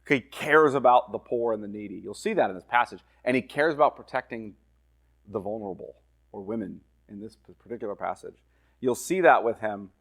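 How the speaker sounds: normal talking voice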